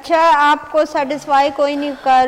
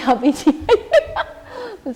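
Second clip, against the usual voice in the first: laughing correction; 12 semitones higher, much slower than usual. Real, not acted.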